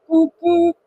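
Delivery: loud boop-boop horn. A narrator's reading. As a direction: honk it like a big engine. Gordon's whistle.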